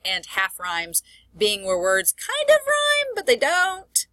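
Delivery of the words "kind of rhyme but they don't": high-pitched, stretched-out hedge; doubtful hedging. Shown as a demonstration. Teacher explaining near-rhymes to students, doubtful hedging. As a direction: draw it out high, as if unconvinced.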